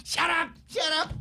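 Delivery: high-pitched mock yell of an old lady. Radio host quoting an old lady in a loved film scene, high mock yelling. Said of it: "then the old lady yelling".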